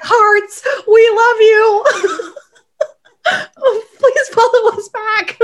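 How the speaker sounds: put-on high squealy fan-girl voice, laughing through it; gushing squeal. Tweeting at the principal, squealing high and teary. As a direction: gush like a starstruck fan.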